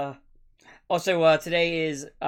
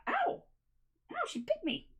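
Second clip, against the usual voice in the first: sharp high yelp of pain; surprised yelp; 6 dB quieter than usual. Real, not acted. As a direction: yelp when the bite lands. Cat bites streamer.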